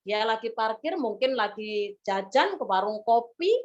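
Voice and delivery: ordinary delivery, nothing marked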